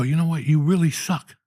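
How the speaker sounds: loud, high, furious telling-off; high-pitched outraged scolding; angry rant. Retold or imitated. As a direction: tear into him at the top of your voice. Telling off the airport kid.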